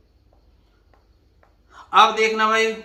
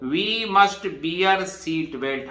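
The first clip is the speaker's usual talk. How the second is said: very slow, with must leaned on; teacher spelling out the modal: slow, must stressed; much slower than usual. Shown as a demonstration. Teacher shows students the must sentence, slow and stressed. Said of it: "देखिए आ गया ना must".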